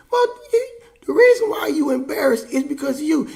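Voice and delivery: high, loud comeback; indignant retort. Acted. Son telling dad the embarrassment is his fault, indignant. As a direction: throw the blame back, pitch jumping up.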